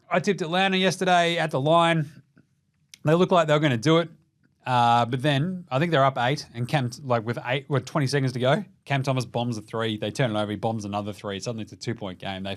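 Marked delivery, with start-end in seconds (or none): none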